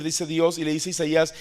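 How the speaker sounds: ordinary delivery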